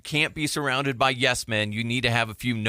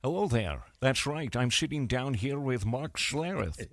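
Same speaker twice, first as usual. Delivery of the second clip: Connery DeHuff voice; 2 semitones higher than usual. Retold or imitated. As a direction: play the smug character greeting viewers. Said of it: "Be like".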